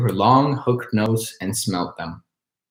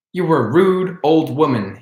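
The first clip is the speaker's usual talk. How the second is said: put-on angry boy; 5 semitones higher, 4 dB louder than usual. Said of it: "he said angrily".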